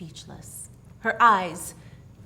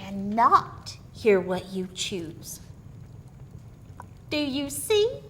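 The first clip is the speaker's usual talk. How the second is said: put-on sly old woman offering a gift, a bit higher and louder; old country woman; 6 semitones higher, 10 dB louder than usual. Old woman coaxing the girl Mary with her strange gift. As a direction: speak as a knowing old crone with a secret.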